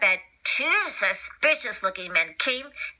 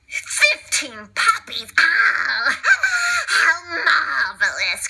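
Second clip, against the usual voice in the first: slow, higher, greedy villain voice; Cruella; 4 semitones higher, much slower than usual. Acted. Cruella eyes the fifteen puppies, slow greedy villain voice. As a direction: savour each word like a greedy villain. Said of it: "cried Cruella".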